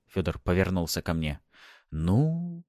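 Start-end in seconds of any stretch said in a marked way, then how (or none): 1.9-2.6 long, rising, hesitant "Ну"; drawled. A narrator's reading. Stalling before proposing his plan, drawled. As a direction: stretch it out and let it climb. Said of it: "протянул я".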